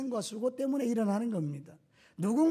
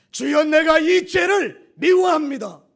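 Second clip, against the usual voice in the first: slow, loud, raised cry of prayer; fervent repentant prayer; 5 semitones higher, 11 dB louder, slower than usual. Acted. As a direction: cry it out slowly to God, much louder. Preacher voicing a repentant prayer. (느리고 크게 외치는 회개 기도)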